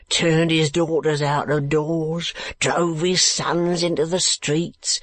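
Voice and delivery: slow, emphatic working-man's voice; keeper, condemning. Acted. Keeper telling Kate of madman's cruelty, slowly condemning. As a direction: draw out each misdeed in rough indignation.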